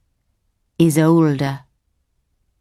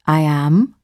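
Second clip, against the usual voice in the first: put-on a touch older and more settled; lightly lowered grown-up voice; older person voice; 2 semitones lower than usual. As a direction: sound older than you are to reassure the client. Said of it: "I use my older person voice".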